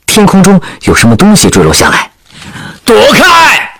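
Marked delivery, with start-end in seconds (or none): 2.8-3.7 high, urgent shouted warning. Acted. Soldier warning comrades as debris falls, urgent high shout. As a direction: yell it high to clear the area.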